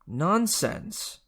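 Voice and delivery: high, scoffing Dionysus voice; dismissive god. A narrator's reading. Dionysus waving off Chiron, scoffing. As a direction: brush it off with lordly scorn. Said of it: "Dionysus said".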